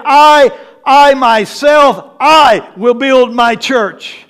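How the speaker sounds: each "I" punched and stretched; emphatic I. Shown as a demonstration. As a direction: hammer "I" and "myself". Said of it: "He was saying".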